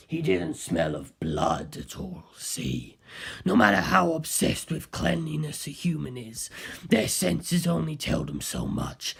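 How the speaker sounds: low and unhurried, sinking to a whisper at the end; Kaz's voice. Acted. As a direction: Kaz calmly explaining to the detective, low, fading to a whisper. Narrator voices Kaz.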